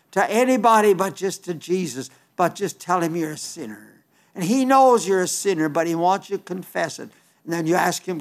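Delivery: ordinary delivery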